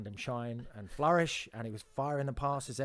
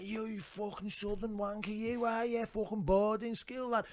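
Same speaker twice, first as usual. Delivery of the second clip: mock aggressive Scouse lad, higher; sneering Scouse voice, pitched up; Scouse thug; 8 semitones higher than usual. Local lad threatening southerner in kitchen, Scouse sneer. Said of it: "he was like".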